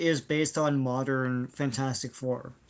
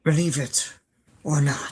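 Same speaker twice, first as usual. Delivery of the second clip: Jack Palance; 2 semitones lower than usual. Retold or imitated. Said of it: "That's my, uh, Jack Palance".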